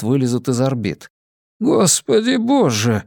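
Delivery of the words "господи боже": high, drawn-out cry of shock; much higher, slow, aghast; stunned exclamation. A narrator's reading. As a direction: stretch it out in horrified awe, pitch well up. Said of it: "прошептал Ки".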